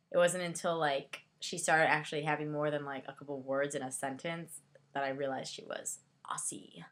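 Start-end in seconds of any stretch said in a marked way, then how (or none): 2.8-6.8 whispered aside, barely voiced. Real, not acted. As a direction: drop to a breathy whisper for the confession. Quiet aside while describing realization.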